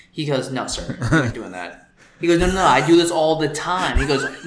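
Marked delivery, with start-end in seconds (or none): 0.5-1.9 lightly voiced firm flight attendant; a hint of a stern attendant saying no; refusing attendant. 2.4-4.0 louder, pushy insistence; insisting passenger. Retold or imitated.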